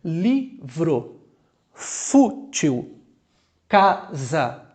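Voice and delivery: Livro, fútil, casa each split into two beats; two separate impulses. Shown as a demonstration. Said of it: "Posso usar dois impulsos".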